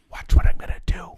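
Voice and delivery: forceful stage whisper; acted-out plotting in a loud whisper; conspiratorial. Dad to son before pranking Leslie, conspiratorial stage whisper.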